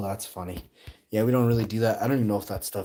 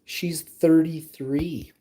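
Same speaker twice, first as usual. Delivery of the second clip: slow reading; 9 semitones higher, 6 dB louder, much slower than usual. Real, not acted. Reading chat slowly.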